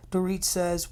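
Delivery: ordinary talk, nothing marked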